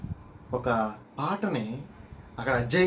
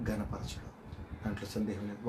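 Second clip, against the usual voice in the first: whispered punchline; 3 semitones lower, 11 dB quieter than usual. Real, not acted. Preacher telling the congregation, sudden whisper. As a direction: whisper the last word, much softer.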